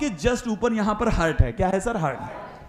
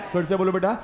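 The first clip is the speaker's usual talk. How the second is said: ordinary talk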